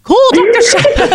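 high, hammed-up British accent with a laugh; exaggerated British accent, laughing through it. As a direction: overplay a British accent on the line. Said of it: "it was completely British".

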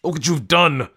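high, panicked yell; loud, high-pitched blame; screamed accusation. A narrator's reading. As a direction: cry out in horror at the mess. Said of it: "Nate screamed at her".

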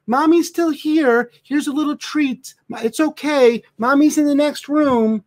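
put-on high, slow baby talk to a dog; mocking baby talk. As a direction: coo at the dog like a doting owner. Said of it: "the dog will stop and say".

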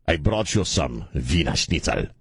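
lightly put-on German accent, slow; a hint of movie German, drawn out. Imitating his buddy Randy in a light German accent. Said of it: "stuff going".

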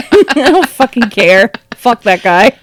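emphatic dismissal through a laugh; laughing defiance. Real, not acted. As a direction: swear it off, amused and defiant. Defiant rant about Elon, laughing.

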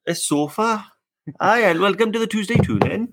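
high, sing-song mock Welsh accent; hammed-up Welsh. Co-host playing along after teasing, hammed-up Welsh. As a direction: ham up a Welsh lilt, pitched high. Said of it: "You sounded really Welsh then".

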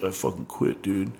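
sullen muttered quit. Retold or imitated. Tony quitting the band, muttered sullenly.

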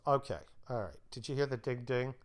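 ordinary delivery

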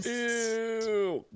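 high squeal of disgust. Real, not acted. Reacting to author self-insert.

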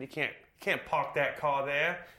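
slow, loud, hammed-up Boston accent. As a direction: ham up a thick Boston accent. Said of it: "That's a terrible Boston accent".

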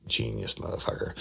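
a soft, breathy sarcastic aside; whispered sarcasm. Retold or imitated. Detective mocking the suspect's slip to himself, whispered sarcasm. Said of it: "I'm like".

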